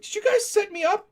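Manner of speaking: high, loud imitation of hurt disbelief; mock betrayed. Adrian asking friends about the prank, mock betrayed squeal. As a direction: ask it as the wounded dupe.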